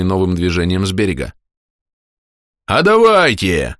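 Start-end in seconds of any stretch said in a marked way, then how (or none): 2.7-3.8 high, slow savage cry; a warrior yelling a challenge; savage shouting. Acted. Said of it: "сказал дикарь".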